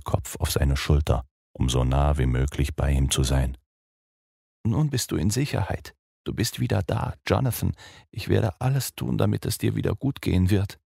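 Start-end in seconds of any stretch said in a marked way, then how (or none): 4.6-10.8 zärtlich tröstend. Acted. Mina tröstet Jonathan zärtlich.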